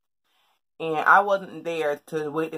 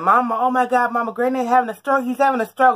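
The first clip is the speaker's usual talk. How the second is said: higher, louder panicked outburst; frightened daughter yelling to mom about the stroke; panicked call to mom; 7 semitones higher, 6 dB louder than usual. Retold or imitated. Telling mom grandpa's stroke.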